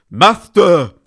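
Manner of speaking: high, loud lisping servant cry; hunchback assistant. Acted. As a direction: call out like a cringing hunchback. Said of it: "lisped Igor".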